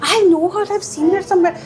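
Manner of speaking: high, excited acted-out realisation; animated, high-pitched mock astonishment; excited recognition. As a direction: act out suddenly spotting a familiar face. Retells spotting her in the lift.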